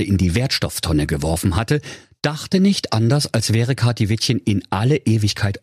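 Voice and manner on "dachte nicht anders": ordinary delivery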